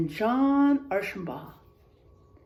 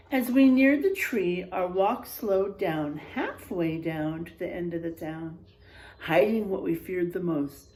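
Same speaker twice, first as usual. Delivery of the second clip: spooky story narration; 5 semitones lower, 3 dB louder than usual. A narrator's reading. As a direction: read it like a campfire tale.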